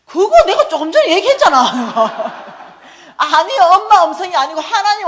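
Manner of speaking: high, loud, laughing indignation; laughing protest. Real, not acted. Preacher laughing at her son's cheek, loud laughing protest. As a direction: laugh out the complaint. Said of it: "speaker's outraged laughing aside".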